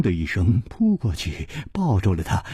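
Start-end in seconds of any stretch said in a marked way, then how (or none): none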